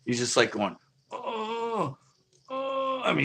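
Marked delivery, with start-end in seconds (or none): 1.1-3.0 high, drawn-out mock moan; imitated moaning. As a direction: imitate an injured man moaning. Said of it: "he's just, like, moaning like crazy".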